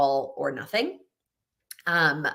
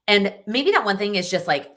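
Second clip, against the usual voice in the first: usual speaking voice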